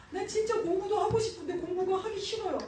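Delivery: high-pitched, mildly whiny protest; lightly acted plaintive kid; mock child's complaint. Voices a child complaining to God.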